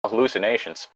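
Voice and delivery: normal talking voice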